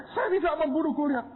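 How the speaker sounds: high, sobbing cry of innocence; crying denial. Retold or imitated. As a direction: wail that you never killed anyone. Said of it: "nangis, nyembah-nyembah". (mahasiswa menangis keras menyangkal membunuh)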